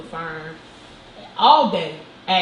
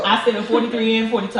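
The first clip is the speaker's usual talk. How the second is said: laughing at herself; 3 semitones higher, 7 dB louder, faster than usual. Real, not acted. Laughing at her own mix-up.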